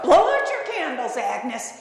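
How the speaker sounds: awkwardly urgent call; urging. Retold or imitated. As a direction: nudge them along urgently. Uneasy owner urging again.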